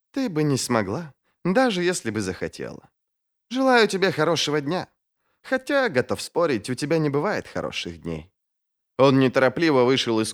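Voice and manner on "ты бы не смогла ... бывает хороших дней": high-pitched, polite mockery; breezy, taunting mage. A narrator's reading. Sulfur taking leave of Ferro, politely taunting. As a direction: needle her with a courteous farewell.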